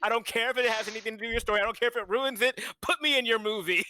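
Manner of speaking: high-pitched, loud, hammed-up ultimatum. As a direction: demand it like an insistent star. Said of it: "[name] was like".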